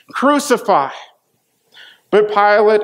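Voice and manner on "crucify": Crucify! pitched higher the second time; rising crowd cry. Acted. Crowd yells Crucify! at Pilate, higher mock shout.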